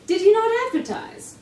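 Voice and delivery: a hint of curious Watson. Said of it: "All accents".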